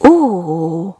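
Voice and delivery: a short startled "Ó!", dropping low; startled gasp. A narrator's reading. Little shoe gasps at the eggs in the nest, startled. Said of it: "hökkent meg a kis cipő".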